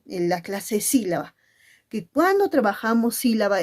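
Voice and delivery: ordinary delivery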